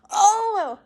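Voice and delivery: mock a caller's dawning recognition; surprised caller greeting. Mum's friend realising he has the daughter, surprised. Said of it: "he goes".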